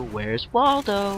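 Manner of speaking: high, very slow, puzzled; enemy looking around, hammed slow and puzzled; confused enemy. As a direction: drawl it like a lost soldier searching. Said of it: "take a little bit of time to".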